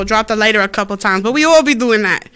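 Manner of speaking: ordinary delivery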